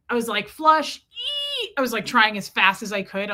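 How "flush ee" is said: high squeal after flush; mock panicked flush. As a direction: act out a flush with a squeaky eee. Acting out a panicked toilet flush.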